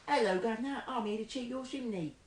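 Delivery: high-pitched chimney sweep character. Shown as a demonstration. Sweep calling on a customer, high chimney sweep voice. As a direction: greet like a cheery storybook chimney sweep. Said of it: "a chimney sweep".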